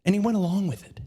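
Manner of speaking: normal talking voice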